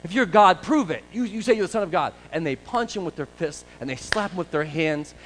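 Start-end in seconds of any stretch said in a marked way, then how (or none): 0.0-2.1 loud jeering taunts at a prisoner; the guards loudly taunting Jesus, mocking shout. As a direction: jeer at him like a cruel guard. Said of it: "and they mock him".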